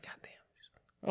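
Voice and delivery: unvoiced whispered curse; whispered awe. Guy asking friend about ogling, whispering. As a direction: breathe it like you're stunned.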